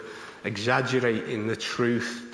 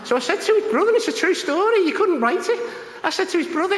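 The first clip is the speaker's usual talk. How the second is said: excited; 5 dB louder, faster than usual. Real, not acted. Punchline of a funny true story.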